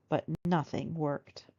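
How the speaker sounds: ordinary delivery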